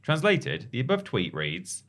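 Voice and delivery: ordinary talk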